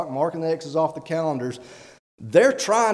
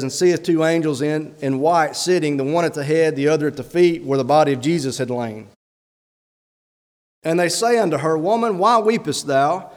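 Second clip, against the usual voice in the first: louder, measured Bible reading; scripture reading; 4 dB louder than usual. Real, not acted. Pastor to church, reading John aloud solemnly. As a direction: read the verses with weight.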